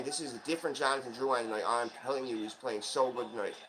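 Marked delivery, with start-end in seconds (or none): none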